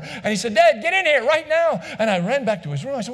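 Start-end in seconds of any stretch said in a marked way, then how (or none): 0.5-1.8 put-on high, loud child yelling for dad; child's scream. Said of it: "screamed bloody murder".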